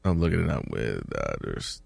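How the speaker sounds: low, very soft mumble while looking something up; absent-minded muttering. Real, not acted. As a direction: trail off under your breath as you search. Checking numbers while on air.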